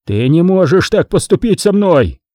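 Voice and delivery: mild narrated bellow of outrage; roaring. A narrator's reading. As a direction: protest hotly without full volume. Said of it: "ревел Ди Джорджи".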